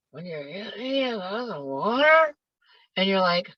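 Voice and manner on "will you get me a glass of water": slurred, unclear mumble; mumbling teenager. Retold or imitated. Teen repeating a request to mom, mumbled. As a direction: barely open your mouth. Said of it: "in this very low, slow way".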